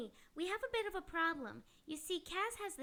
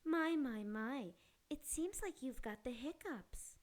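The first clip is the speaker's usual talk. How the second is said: sultry maid voice; 6 semitones lower, 4 dB quieter than usual. Acted. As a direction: purr it like a flirty maid. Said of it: "I'm not really Mistress Maid".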